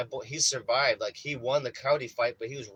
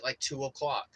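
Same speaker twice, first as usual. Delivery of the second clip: ordinary talk, nothing marked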